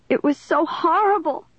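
high-pitched tearful wail. A narrator's reading. Caitlin to the police officer, high wailing. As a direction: wail it in put-on distress. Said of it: "she wailed to the solemn-faced officer".

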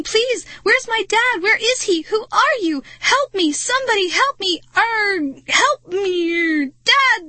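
high, loud panic building to a wail; hysterical shrieking. A narrator's reading. Girl screaming for her real dad, hysterical. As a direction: go from alarmed accusation to screaming for help. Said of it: "I shrieked".

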